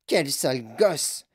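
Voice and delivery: lightly voiced cranky grandmother; disgusted old woman. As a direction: sniff at the brat. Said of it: "dit grand-maman Joséphine".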